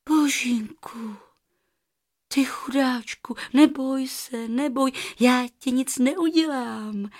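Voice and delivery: put-on hushed little-girl reassurance, starting slowly; soothing. A narrator's reading. Girl comforts a trapped goose, soothing. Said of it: "řekla Rozárka konejživě".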